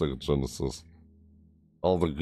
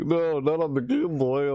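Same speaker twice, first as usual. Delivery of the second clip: much higher-pitched mock protest; retold protest; 11 semitones higher than usual. Speaker retelling a car sickness story, high-pitched protest. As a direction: replay an old objection in a raised pitch.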